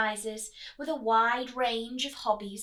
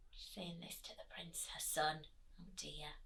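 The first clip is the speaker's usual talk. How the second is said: soft whisper to the listeners; whispered aside; 9 semitones lower, 17 dB quieter than usual. Real, not acted. Storyteller whispering to the children about the Queen. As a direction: drop to a hush, just for them.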